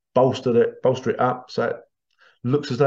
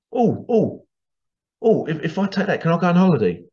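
high-pitched mock excitement; higher, wheedling excited question; mock eagerness; 4 semitones higher than usual. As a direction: play an overeager holidaymaker asking permission. Acting an eager holidaymaker.

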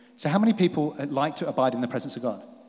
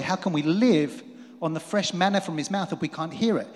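usual speaking voice

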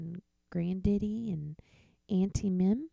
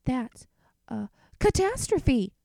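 dismay; 7 semitones higher, 5 dB louder than usual. Acted. Boy dismayed at losing toys.

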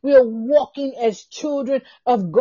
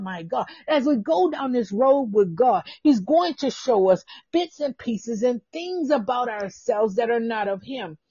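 ordinary delivery

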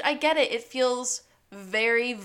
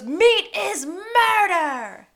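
high, loud, drawn-out slogan cry; mock protest shout; 10 semitones higher, 9 dB louder, much slower than usual. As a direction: imitate an outraged protester yelling slowly. Acted protester's slogan retort.

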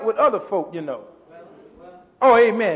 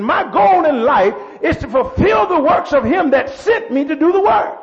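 full-force preaching; 4 semitones higher than usual. Real, not acted. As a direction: drive the point home like a fired-up preacher.

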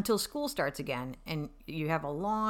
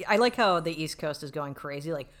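ordinary delivery

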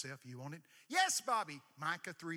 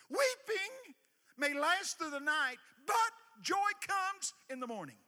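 preaching shout; 13 semitones higher, 8 dB louder, slower than usual. Acted. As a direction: belt the verse high and loud, stretching it out. Preacher belting the famous verse.

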